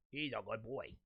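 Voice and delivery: soft, low cooing praise; dog praise. Real, not acted. Host praising his dog Chester on air, soft and low. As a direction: coo to the dog softly.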